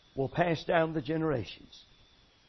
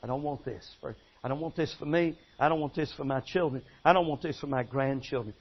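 hushed, lower pleading prayer; soft prayer; 6 semitones lower, 6 dB quieter, faster than usual. Shown as a demonstration. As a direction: model the prayer softly for the congregation.

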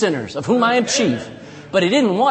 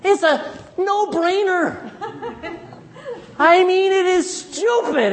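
laughing; 11 semitones higher, slower than usual. Real, not acted. Preacher laughing at the absurdity.

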